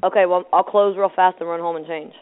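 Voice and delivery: ordinary delivery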